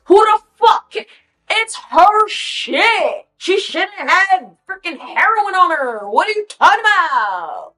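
high, drawn-out indignant protest; incredulous outrage. Acted. Outraged retort to taking the charge.

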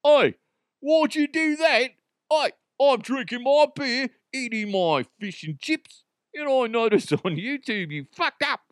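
high, loud mock British accent; a bad British accent, mocking a UK commenter. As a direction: ham up a pub-bloke British accent. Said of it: "That's a very bad, uh, British accent".

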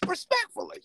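high put-on Stumbles voice. Shown as a demonstration. Teasing James in his Stumbles voice, high and put-on. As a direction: squeak it out like Stumbles. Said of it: "In my Stumbles voice".

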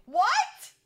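high, loud rising "what"; shocked outburst. Retold or imitated. Comic reacts to the huge price jump, shocked. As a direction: blurt it out in disbelief.

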